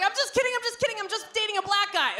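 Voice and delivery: high, quick backpedaling; panicked joke rescue; frantic backpedal. Retold or imitated. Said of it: "I was like".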